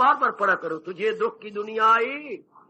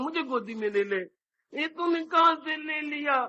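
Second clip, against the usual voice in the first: put-on childish demand; 5 semitones higher, 4 dB quieter than usual. Toddler again demanding lap.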